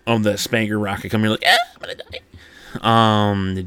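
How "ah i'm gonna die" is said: very high squealed terror; hammed-up high-pitched panic; mock panic. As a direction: squeak in comic fear of dying. Acting panic at incoming rocket.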